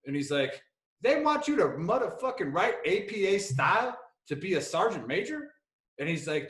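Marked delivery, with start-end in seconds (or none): 1.0-5.5 higher, lightly indignant imitation of a buddy's accent; King's Buddy Beckwith accent. Buddy complaining about promotion rules, incredulous mimicked accent. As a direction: put on his accent, incredulous. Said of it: "his Buddy Beckwith accent".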